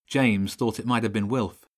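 plain reading voice

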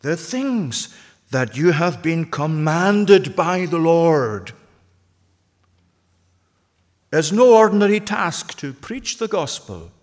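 slow, emphatic pulpit proclamation; emphatic preaching. Real, not acted. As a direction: lay down each word with weight. Climactic preaching after long pause.

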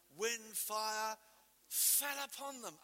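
ordinary delivery

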